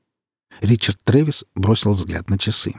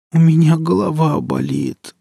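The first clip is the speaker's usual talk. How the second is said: high, wailing complaint; ailing mother's high groan; pained moan; 8 semitones higher than usual. A narrator's reading. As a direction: moan it plaintively, pitched up. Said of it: "простонала маман".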